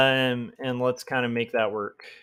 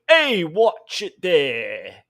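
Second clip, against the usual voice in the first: loud, drawn-out mock Australian call; hammed-up, wobbly semi-Australian; weird semi-Australian accent; 3 semitones higher, 5 dB louder, much slower than usual. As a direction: bark it slowly in a muddled Australian accent. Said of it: "semi-Australian".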